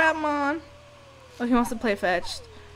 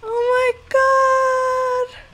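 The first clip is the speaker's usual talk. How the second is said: stretched-out gasp; 12 semitones higher, 6 dB louder, much slower than usual. Real, not acted. Group arrives.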